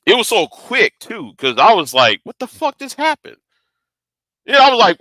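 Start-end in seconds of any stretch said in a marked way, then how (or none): 2.2-3.4 high, hushed retold bewilderment; stunned disbelief. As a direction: replay being baffled, high-pitched and softer. Retelling his shock at the hit.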